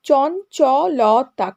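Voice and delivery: "চঞ্চলতা" slowly, split into separate syllables. Shown as a demonstration. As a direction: count out each syllable of "চঞ্চলতা". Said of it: "আঙুলে গুনলে বুঝতে পারবে". ("চঞ্চলতা" দল ভেঙে ধীরে ধীরে)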